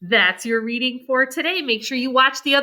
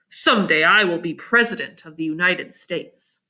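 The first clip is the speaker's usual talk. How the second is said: Abe, proudly; 8 semitones lower than usual. Acted. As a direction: lower voice, a touch of pride. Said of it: "said Abe proudly".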